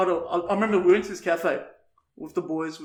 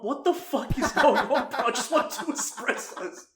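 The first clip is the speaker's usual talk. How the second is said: high, slow disbelief through laughter; laughing disbelief; 7 semitones higher than usual. Retold or imitated. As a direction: laugh out the confusion, slowly and high. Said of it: "I'm like".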